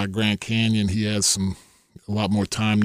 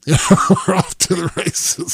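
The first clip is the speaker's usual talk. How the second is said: laughing, higher; 7 semitones higher, 5 dB louder than usual. Real, not acted. Recalling Barkley's twelve on the first hole, laughing. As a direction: laugh through the words.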